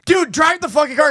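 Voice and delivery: high, insistent plea; urgent demand. Retold or imitated. Retelling mooner begging his buddy to drive, urgent. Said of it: "I was like".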